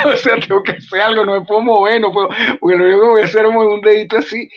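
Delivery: laughing at how absurd the scene was; excited, laughing. Retold or imitated. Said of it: "playing the paralyzed character".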